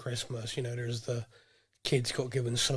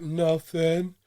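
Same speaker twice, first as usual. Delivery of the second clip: put-on innocent child voice; 4 semitones higher than usual.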